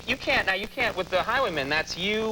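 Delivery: ordinary talk, nothing marked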